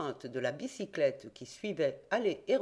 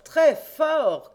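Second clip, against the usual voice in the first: high, loud ringing repeat; louder shout; 10 semitones higher, 12 dB louder than usual. A narrator's reading. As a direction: push the praise out even louder. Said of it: "cria de sa place Frédéric Larsan".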